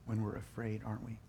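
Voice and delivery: soft, hushed echo; quietly letting it sink in; hushed repeat. Real, not acted. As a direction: drop it down to a near hush. Repeats the phrase softer.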